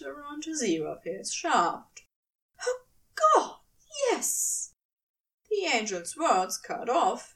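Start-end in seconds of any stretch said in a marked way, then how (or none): 2.6-4.7 cry of pleasure. A narrator's reading. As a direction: let it burst out. Angel cries out in pleasure.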